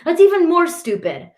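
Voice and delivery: high-pitched, irritated scolding; mild growl. A narrator's reading. Brother calling Ali's excuse stupid, irritated growl. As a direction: snap scornfully at a brother. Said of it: "Shirzad growls at me".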